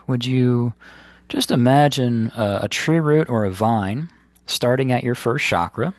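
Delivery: unhurried phrases with long, restful pauses; guided meditation. Acted. Meditation teacher guiding listeners to ground the body, in guided meditation style. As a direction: guide them gently inward, leaving space between instructions.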